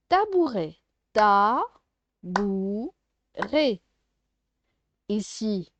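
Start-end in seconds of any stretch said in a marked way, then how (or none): 1.1-3.8 "Ta, bou, ré" in stretched, separate beats; syllable by syllable. Shown as a demonstration. Teacher sounding out syllables for a child, "Ta, bou, ré" apart. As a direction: pause between "Ta", "bou" and "ré". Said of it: "de faire les syllabes".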